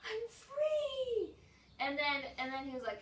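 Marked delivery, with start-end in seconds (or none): 0.0-1.3 mock cheer. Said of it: "And then I was like".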